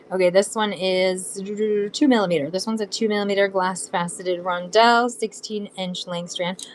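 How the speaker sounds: normal talking voice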